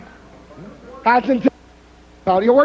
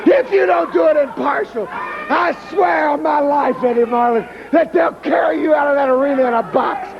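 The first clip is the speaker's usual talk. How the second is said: yelling in outrage, voice pitched up; furious shout. Real, not acted. Wrestler raging at Eddie Marlin over the cage match, shouting furiously. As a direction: blow up and holler every line. Wrestler threatening the referee on air.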